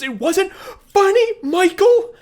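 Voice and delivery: high, loud, slow outburst; lost temper shout. Retold or imitated. Showalter yelling at Michael on the terrace, furious and high. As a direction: snap at him, losing it. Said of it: "Showalter loses his temper".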